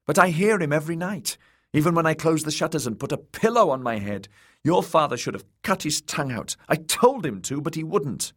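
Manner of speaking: put-on high, agitated boy's protest; upset little lord insisting; insistent child. A narrator's reading. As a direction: pour it out in a high young voice. Frightened boy insists against being soothed.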